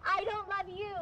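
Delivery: huffy pretend exit; acted anger. Girlfriend keeps up mad act while walking out, mock anger. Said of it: "Keep being mad".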